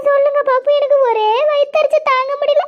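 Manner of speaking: high, tearful wail of a hurt woman; weepy lament about the tickets; crying complaint. Acted. Complaining woman breaks into tears.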